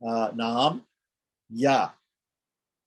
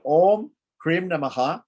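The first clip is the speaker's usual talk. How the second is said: slow, carried mantra with Om lifted; slow intoned mantra; 5 semitones higher, 4 dB louder than usual. Shown as a demonstration. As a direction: intone it slowly for the students. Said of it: "recites the mantra".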